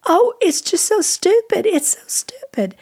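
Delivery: high, tearful retold wail; imitated sobbing. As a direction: mimic a woman too upset to explain. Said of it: "And she just sobbed, and she said".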